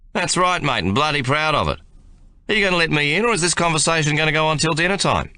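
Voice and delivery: a broad Australian soldier teasing. A narrator's reading. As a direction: lean on the Australian accent to needle him. Ben's cheeky Australian reply.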